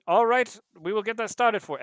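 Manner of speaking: high, loud polite machine; computer butler voice. Acted. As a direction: announce it like a servant. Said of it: "And he says".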